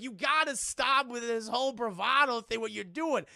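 lightly acted urgent reproach; exasperated plea. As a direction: plead with a stubborn friend. Acting pleading character.

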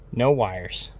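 ordinary delivery

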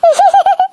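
high-pitched snickering giggle; a quick burst of tittering. Acted. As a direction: giggle at a classmate's scolding. Pupil giggles at a scolded classmate.

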